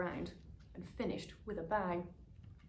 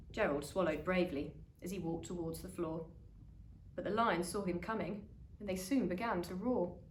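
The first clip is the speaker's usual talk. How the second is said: ordinary delivery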